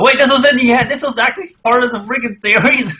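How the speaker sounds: high-pitched mock outraged surprise; incredulous outburst, voice shooting up; shocked disbelief. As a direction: play a fan floored by the discovery. Voicing a shocked manga reader's reaction.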